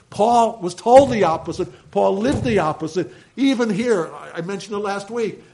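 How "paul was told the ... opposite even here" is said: loud, driving preacher's emphasis; fiery pulpit proclamation; preaching at full force. Real, not acted. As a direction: hammer home each line to the congregation.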